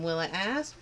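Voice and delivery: ordinary delivery, nothing marked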